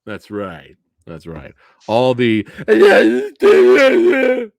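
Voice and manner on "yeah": loud, high gibberish yell imitating haters; mock jeering noise. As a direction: jeer in loud, high nonsense syllables.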